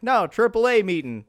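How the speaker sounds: mock insistent correction. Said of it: "his third cousin is like".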